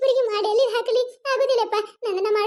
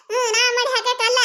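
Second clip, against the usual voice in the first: ordinary delivery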